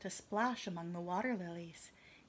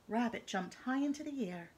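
plain reading voice

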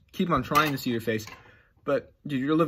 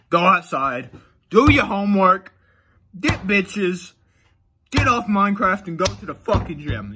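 louder, higher bossy dad orders; mock father lecture; 7 semitones higher, 6 dB louder, slower than usual. Telling Dream what a father would say, mock bossy dad. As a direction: bark it like a pushy dad. Playing a stern father figure.

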